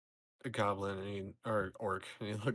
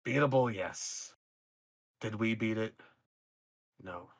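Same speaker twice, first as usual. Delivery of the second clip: a hint of a beaten old orc; weary orc admitting defeat; lightly voiced gloomy orc; 3 dB louder than usual.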